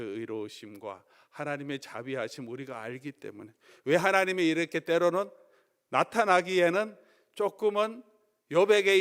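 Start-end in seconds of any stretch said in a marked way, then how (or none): none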